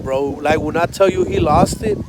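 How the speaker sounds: ordinary delivery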